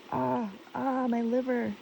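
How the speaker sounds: high narrated sigh. A narrator's reading. Underworld queen sighs on, sighing. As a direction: let the ahs float up in a sigh. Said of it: "She sighed".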